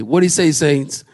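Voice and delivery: usual speaking voice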